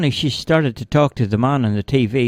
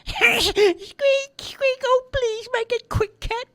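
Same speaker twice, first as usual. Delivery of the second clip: tiny, very high pleading mouse; fearful mouse squeal; slower than usual. A narrator's reading. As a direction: squeak out a plea for mercy. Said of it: "the mouse squealed out in fear".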